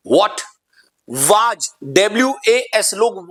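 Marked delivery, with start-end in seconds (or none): 0.1-0.4 what said "wot"; rounded o in व्हाट; effective pronunciation. Shown as a demonstration. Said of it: "effective pronunciation क्या हुआ". (व्हाट को "वॉट" जैसा)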